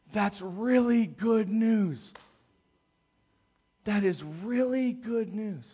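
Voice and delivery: slow, stunned and tender; awed quiet. Retold or imitated. Pastor retelling the cop's moved reply, slow and awed. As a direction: let the words sink in slowly. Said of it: "I'll never forget it. He says".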